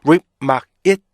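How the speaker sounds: "whip, 막, it, 음, 제" as separate stopped beats; punchy syllable-by-syllable drill with gaps; five-beat breakdown. Shown as a demonstration. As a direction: chant the pieces of remind one by one. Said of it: "총 다섯 개의 리듬으로".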